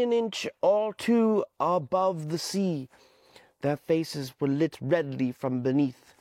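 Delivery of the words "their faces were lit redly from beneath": ordinary delivery, nothing marked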